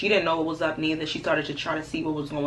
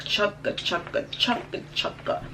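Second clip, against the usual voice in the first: fading chugga of a dying car engine, breathier each time; sputtering engine noise; 4 semitones higher, slower than usual. Retold or imitated. Telling friends how the car died, mimicking the sputtering engine. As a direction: mimic the car coughing to a stop.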